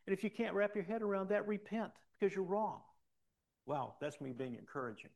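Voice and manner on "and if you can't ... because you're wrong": loud, high-pitched exhortation; forceful preaching. Real, not acted. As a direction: drive the rebuke home from the pulpit.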